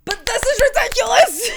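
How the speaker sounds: fed-up fan scolding a character; exasperated scolding. Real, not acted.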